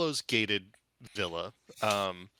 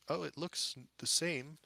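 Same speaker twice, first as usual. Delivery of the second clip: confused butler; 7 semitones higher, 4 dB quieter than usual. Acted. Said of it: "and he goes".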